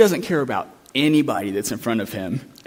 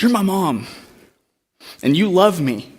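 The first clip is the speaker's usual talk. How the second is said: emotional realization; 6 semitones higher, 4 dB louder than usual. Retold or imitated. Girl to her mom.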